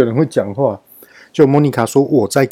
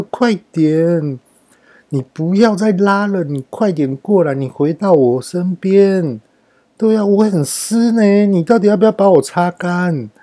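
put-on high, whiny drunken coaxing; drunk pleading with towel; 6 semitones higher than usual. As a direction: beg the towel to come, drawn-out and pitched up. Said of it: "结果后来我竟然跟浴巾讲说". (醉后撒娇地求浴巾过来)

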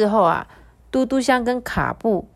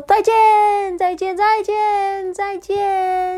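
high, slow sing-song farewells; chirpy sparrow goodbye; 13 semitones higher, 4 dB louder, slower than usual. Acted. Sparrows fly off saying goodbye, high sing-song. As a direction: wave goodbye like little birds.

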